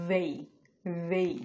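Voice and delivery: "they" with a strong, clear voiced th; exaggerated they. Shown as a demonstration. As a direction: overdo the th in "they" so learners hear it. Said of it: "একটু exaggerate করে pronounce করতেছি". ("they" দ sound জোর দিয়ে বাড়িয়ে বলা)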